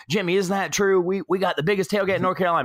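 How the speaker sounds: high, quick imitated brag; outgoing tailgater. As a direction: play an outgoing guy seeking backup from his buddy. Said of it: "the guy was super outgoing".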